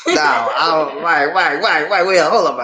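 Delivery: high-pitched, flustered disbelief; real stunned questioning, repeating words urgently; incredulous. Real, not acted. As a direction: stop everything, can't believe it. Startled by the message, interrupting.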